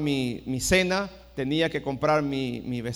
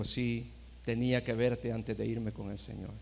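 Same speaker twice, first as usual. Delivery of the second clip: frail dying mother; 7 semitones lower, 11 dB quieter than usual. Retold or imitated. Dying mother speaks to her son.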